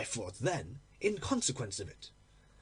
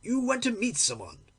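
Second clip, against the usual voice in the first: higher and louder accusing cry; cried out; 7 semitones higher, 3 dB louder than usual. Acted. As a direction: fling the accusation at her. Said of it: "I cried".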